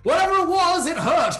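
high, loud outburst; loud exclamation. Real, not acted. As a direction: blurt it out, emphatically high. Exclaims over the throat injury.

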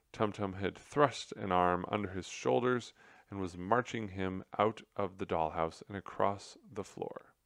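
plain reading voice

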